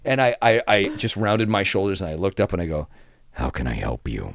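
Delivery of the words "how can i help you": very low, slow and soft; quiet menace. Retold or imitated. Front desk agent facing a biker-looking guest, quietly intimidating. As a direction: offer help like a veiled threat. Staring down an approaching angry guest.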